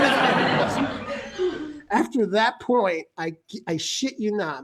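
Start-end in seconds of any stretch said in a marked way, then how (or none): none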